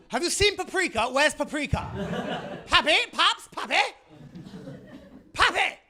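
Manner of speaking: high, loud posh mother calling out; posh parent calling. Retold or imitated. A posh parent calling a child named Paprika, high mock shout. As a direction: call the child across the garden, shrill and rising. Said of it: "You can hear the parents shouting them".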